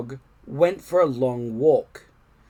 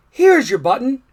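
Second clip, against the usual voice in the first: high, louder friendly frog voice; reassuring Frog; 9 semitones higher, 8 dB louder than usual. A narrator's reading. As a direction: Frog cheerfully promising help. Frog offers a button.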